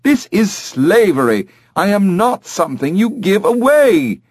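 high, appalled protest; horrified. Acted. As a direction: protest in shrieky-high parrot outrage. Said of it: "in a horrified tone".

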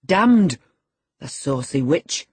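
loud, punched curse falling away; surly lad. A narrator's reading. Lad replying to the haughty girl, surly growl. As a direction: throw the curse out roughly. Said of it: "growled the lad".

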